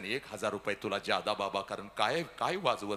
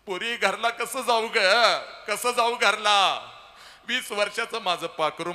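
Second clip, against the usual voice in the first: mother wailing; 9 semitones higher, 10 dB louder than usual. Retold or imitated. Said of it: "पहिला हंबरडा तुझी आई फोडते".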